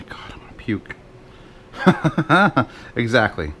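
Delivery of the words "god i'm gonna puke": whispered disgust; whispering. Real, not acted. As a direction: whisper it, mock queasy.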